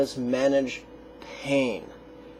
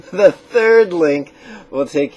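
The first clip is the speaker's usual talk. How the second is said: laughing; 4 semitones higher, 10 dB louder, slower than usual. Real, not acted. Still laughing from his remark.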